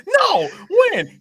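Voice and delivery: high, loud outraged squeak; imitating friend's shrill denial; mock indignation. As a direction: replay the shocked denial. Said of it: "as soon as Chris says".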